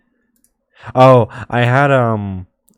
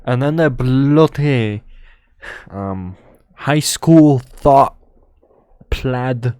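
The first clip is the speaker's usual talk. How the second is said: put-on playful British accent; 4 semitones higher, 6 dB louder, slower than usual. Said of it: "British accent".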